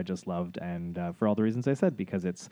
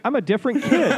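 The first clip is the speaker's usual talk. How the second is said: put-on high, loud dopey kid voice, laughing through it; mock-innocent child answering the cop; goofy kid voice; 12 semitones higher, 7 dB louder, faster than usual. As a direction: play the kid dodging the cop. Imitating a kid in a sketch.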